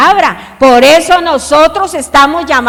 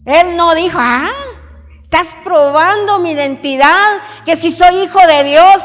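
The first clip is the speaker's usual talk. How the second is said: higher, louder preacher's cry; full-force preaching; 6 semitones higher, 5 dB louder, slower than usual. Acted. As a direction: preach it out, rising to the congregation.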